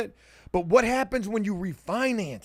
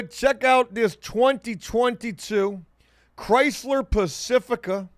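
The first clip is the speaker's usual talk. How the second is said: showman slow reveal; 3 semitones higher, slower than usual. Real, not acted. Presenting car slowly.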